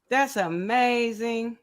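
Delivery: ordinary delivery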